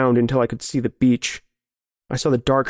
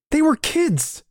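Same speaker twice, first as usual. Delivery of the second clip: high-pitched, appalled protest; anguished protest; slower than usual. Acted. Narrator to friend who killed children, anguished protest. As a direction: plead the horror of it, voice leaping high. Quarrel over the killings.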